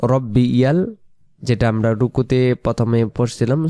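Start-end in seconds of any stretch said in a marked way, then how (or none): 0.0-0.9 slow, careful Arabic recitation; measured model reading; tasbih recitation. Shown as a demonstration. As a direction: lengthen the vowels of the praise. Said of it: "সেটা হলো".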